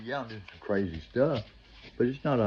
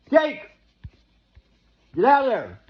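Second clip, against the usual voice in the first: high, loud desperate yell of a warning; frantic shout; 11 dB louder than usual. Retold or imitated. Believer yelling at Keith to get out, frantic shout. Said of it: "that still believes".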